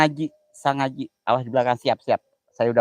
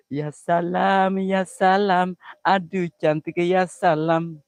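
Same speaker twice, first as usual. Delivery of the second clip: slow, drawn-out ya salam; delighted ya salam; 6 semitones higher, 4 dB louder, much slower than usual. Acted. Streamer swooning again, a touch less high. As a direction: savour each ya salam with delight.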